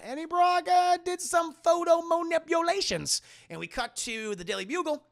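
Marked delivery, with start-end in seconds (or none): 0.0-3.2 high, held singsong announcement; mock announcement. Streamer reveals the photo fakery to chat, high mock announcement. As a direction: sing it out loud and high.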